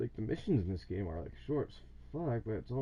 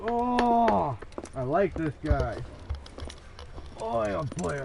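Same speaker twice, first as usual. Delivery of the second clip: excited; 6 semitones higher, 8 dB louder, much slower than usual. Real, not acted. Gamer cheering at a game character.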